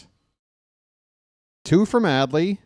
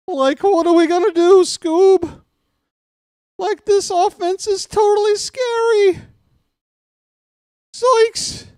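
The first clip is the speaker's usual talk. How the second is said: much higher, loud goofy cartoon slacker; cartoon sidekick voice; 6 dB louder than usual. Booth announcer goofing on air as a scared cartoon sidekick. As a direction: squeal it like a spooked cartoon dog owner.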